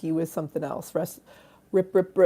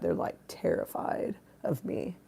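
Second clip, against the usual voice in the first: hushed aside; 7 semitones lower, 11 dB quieter than usual. Real, not acted. Teasing aside about silent viewers.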